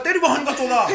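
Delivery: high, loud mock curse; abusive caller. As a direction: burst in like a furious customer, played for laughs. Caller's abuse cuts off greeting. (गाली देते ग्राहक की चिल्लाती नकल)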